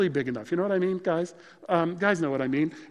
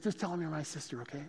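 hushed coaxing; 11 dB quieter than usual. Retold or imitated. Abraham repeats the request softly.

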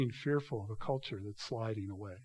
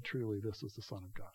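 awed hush; 6 semitones lower, 11 dB quieter than usual. A narrator's reading. Awed centurions' words.

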